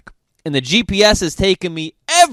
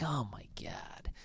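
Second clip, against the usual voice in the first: slow hushed "oh my God" whisper; hushed, stunned whisper; whispered gasp; 2 semitones lower, 24 dB quieter than usual. Retold or imitated. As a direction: breathe it out in quiet shock. Shocked at kids in gutter.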